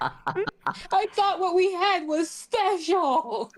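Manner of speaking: high, slow, hammed-up hurt; mock heartbroken. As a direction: play the jilted lover, high and drawn out. Playing along as jilted partner.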